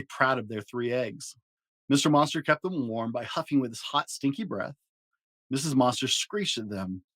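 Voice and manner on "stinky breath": usual speaking voice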